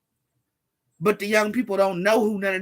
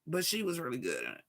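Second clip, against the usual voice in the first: quiet echo; 5 semitones lower, 11 dB quieter, faster than usual. Shown as a demonstration.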